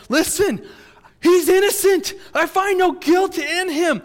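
high, shouted plea of innocence; acted exasperated governor at full volume; insistent protest. Pilate insists Jesus is innocent.

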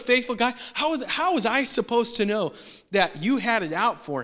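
high, urgent pleading, protesting innocence; defensive priest. Retold or imitated. Priest pleading with Saul, high and urgent. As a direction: plead your case to an angry king.

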